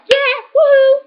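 high, loud whoop; excited cheer. Real, not acted. Cheering to viewers, high loud whoop. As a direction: let out a happy woo. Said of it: "cheering after healing".

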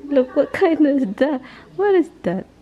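high-pitched mock telling-off; playful scolding. Real, not acted. Telling the monkey to close her legs, playful scolding. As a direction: scold a pet fondly, pitched high, on the edge of laughter.

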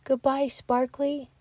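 soft, sad goodbye; sad whisper. A narrator's reading. Bear cub saying goodbye to gone friend, sad whisper. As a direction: whisper it with a heavy heart. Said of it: "Little Bear whispered sadly".

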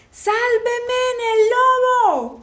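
very high, loud cry for help; long, desperate high yell; shout. A narrator's reading. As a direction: scream for rescue, drawing out the last word. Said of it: "gritó".

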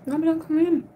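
squealy hello to chat; high excited greeting. Real, not acted. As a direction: greet each viewer like a long-lost friend. Greeting viewers on a live stream.

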